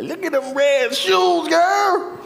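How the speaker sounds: very high, drawn-out admiring cry; hammed-up gushing. Husband gushes over his wife's shoes, exaggerated excitement. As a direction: squeal the compliment, stretching it out.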